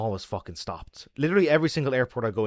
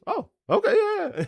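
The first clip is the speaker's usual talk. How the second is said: much higher, eager airport clerk; squeaky happy local clerk taking crisps; high-pitched delighted desk clerk. Retold or imitated. Airport clerk voiced in story.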